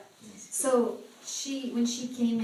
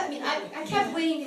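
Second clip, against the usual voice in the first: usual speaking voice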